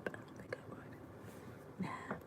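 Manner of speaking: hushed secret whisper. Real, not acted. Telling friends a secret about husband, whispered. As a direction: whisper it so he won't hear. Confiding aside, don't tell him.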